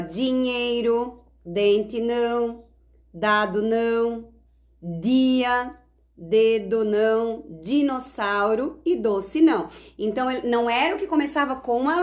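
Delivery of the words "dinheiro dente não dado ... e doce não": each word drawn out slowly, checking the syllable; slow word-by-word demo. Shown as a demonstration. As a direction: read out the pictures slowly, as if to young kids. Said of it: "o que tinha a mesma sílaba". (leitura lenta, palavra por palavra)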